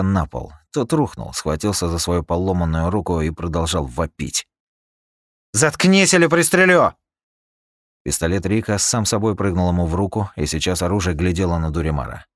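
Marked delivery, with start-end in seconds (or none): 5.5-6.9 high, loud furious threat; angry threat. Acted. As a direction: bark the threat at him. Rika threatens screaming Durimar.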